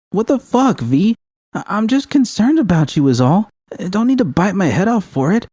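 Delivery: she's stung, let it fly; hurt outrage. Acted. Judy popping up in bed, hurt and outraged at V.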